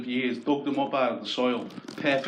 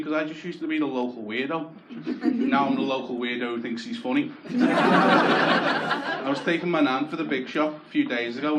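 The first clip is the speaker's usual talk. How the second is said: ordinary talk, nothing marked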